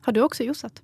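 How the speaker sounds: usual speaking voice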